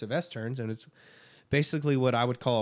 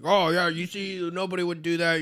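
louder, higher mock scolding; mocking imitation; 5 semitones higher, 5 dB louder than usual. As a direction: imitate a know-it-all telling someone off. Mimics the man berating him publicly.

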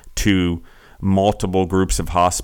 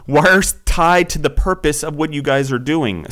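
raised voice, worked up and higher; loud passionate rant; 8 semitones higher, 3 dB louder than usual. Real, not acted. Host venting about a company that ignores its history, loud and worked up. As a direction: let the outrage spill into the mic. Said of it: "I'm yelling into the mic a little loud".

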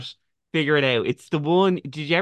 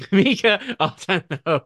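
giggling repetition; laughing repeat; 5 semitones higher, slower than usual. Real, not acted. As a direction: echo the joke with a laugh. Echoes the joke laughing.

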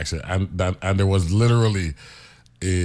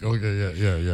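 ordinary talk

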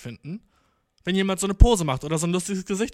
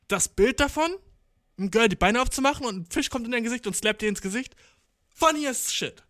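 excited retelling; 6 semitones higher than usual. Retold or imitated. Retelling her own excited reaction.

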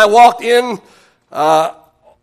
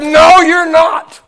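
slow, stern and raised; higher, louder stern refusal, each word dragged; 6 semitones higher, much slower than usual. Retold or imitated. Church lady refusing the young preacher again, louder and stern. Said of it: "said with a very stern voice".

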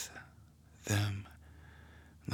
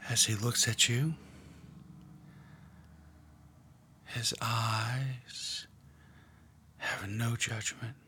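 guided meditation; 5 dB quieter than usual. Acted. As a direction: draw them gently into the scene. Leads a guided prayer meditation.

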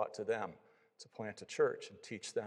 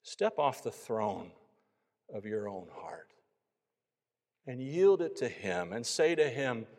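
gentle, pleading altar call; preacher's tender appeal; 3 semitones higher than usual. Real, not acted. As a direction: lilt the questions upward, then slow to a hush. Pleads with listeners to come to faith.

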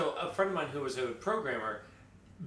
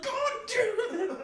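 much higher, slow, laughing groan of annoyance; fed-up coworker; 5 dB louder, slower than usual. Retold or imitated. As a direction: drag it out like the prank victim, cracking up. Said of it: "He'd be like".

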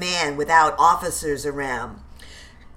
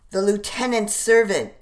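ordinary delivery

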